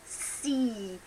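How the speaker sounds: "See" with a long spread smiling ee; demo see. Shown as a demonstration. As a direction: stretch the ee in "See" with spread lips. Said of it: "As in see".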